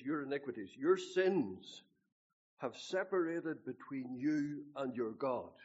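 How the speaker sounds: slow, loud scripture quote; preacher quoting scripture. Retold or imitated. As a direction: thunder out the verse like the preacher. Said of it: "It says".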